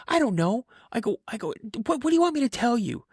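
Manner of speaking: high, frustrated back-talk; acted squeaky-high exasperation; exasperated kid. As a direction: throw it back at dad, pitch climbing sharply. Exasperated kid argues with dad.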